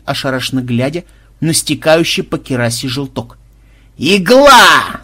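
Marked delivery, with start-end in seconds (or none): 4.0-5.0 very loud, high, drawn-out yell. A narrator's reading. Banzai remembers the villain's weak spot, yelling. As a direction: bellow the sudden realisation. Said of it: "заорал Банзай".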